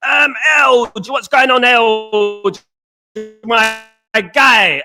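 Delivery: loud, high call to a viewer in chat; shouted greeting. Real, not acted. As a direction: holler hello across the room.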